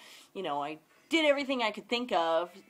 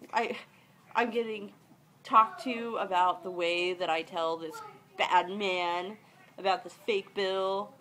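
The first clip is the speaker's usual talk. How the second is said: ordinary delivery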